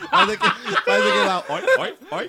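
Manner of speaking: very high, loud laughing squeak; imitating Moção. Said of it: "todo mundo imitando Moção". (imitação do Moção, bem aguda)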